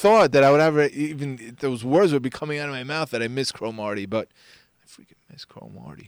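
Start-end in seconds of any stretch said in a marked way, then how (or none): 4.8-6.1 hushed, sheepish whisper; whispered confession. Real, not acted. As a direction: whisper it like a guilty secret. Confessing his love for a rival.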